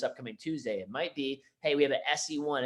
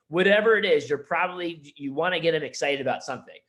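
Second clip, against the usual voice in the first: usual speaking voice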